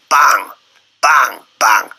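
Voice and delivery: loud, separate bangs; punchy retold blows. Recounting Viv knocking out the three Scots, punchy retold blows. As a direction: punch out each bang like a landed blow.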